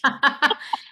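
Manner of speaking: short, high burst of laughter. Real, not acted. Co-host amused by the tech hiccup, short high laugh. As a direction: laugh it out briefly. Amused at co-host's technology trouble.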